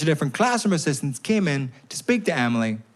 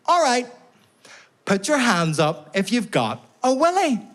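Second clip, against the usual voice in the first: chirpy teacher voice; 11 semitones higher than usual. Retold or imitated. Sex-ed lady addressing class.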